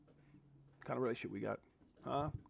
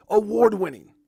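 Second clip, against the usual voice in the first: booming announcer call; 3 semitones higher, 8 dB louder than usual. Shown as a demonstration. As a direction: shout it out loud, hype announcer. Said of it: "I should start saying that".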